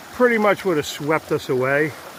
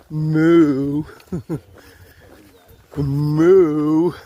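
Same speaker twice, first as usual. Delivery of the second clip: mooing at cows; 5 dB louder than usual. Pretend. Hiker mooing back at cows.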